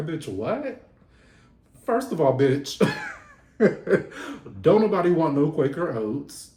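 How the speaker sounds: loud, sassy comeback with a laugh; Celine's retort. Retold or imitated. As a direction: snap back, offended. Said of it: "Celine said".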